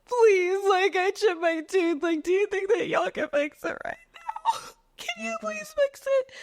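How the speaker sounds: very high, whining mock sobs; hammed-up crying plea; tearful begging. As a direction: beg through exaggerated tears, voice climbing to a squeak. Retold tearful begging at dentist.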